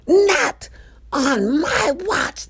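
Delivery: high, loud, drawn-out catchphrase; not-on-my-watch lady. Acted. Imitating the watch lady for viewers, high theatrical proclamation. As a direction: hit the first word high and hard, then stretch the rest. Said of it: "The not on my watch lady".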